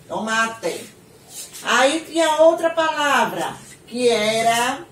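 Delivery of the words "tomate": "tomate" ending in a clear TE, not TI; spelling pronunciation. Shown as a demonstration. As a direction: say "tomate" as written, final E sounded. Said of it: "significa que é té". ("tomate" com o E final bem pronunciado)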